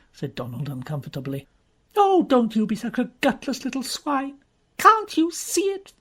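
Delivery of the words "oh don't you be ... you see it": high, loud scornful snap; contemptuous anger. Acted. Jean turning on Donald, high and scornful. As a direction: spit it at him in disgust.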